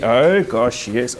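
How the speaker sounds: normal talking voice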